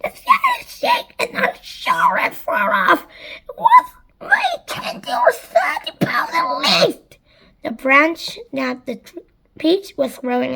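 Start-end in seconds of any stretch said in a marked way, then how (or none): 0.0-7.2 very high, squawky character voice; high, excited squawk; excited aunt. A narrator's reading. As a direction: exclaim it in a high-pitched aunt voice. Aunt's excited line in the story.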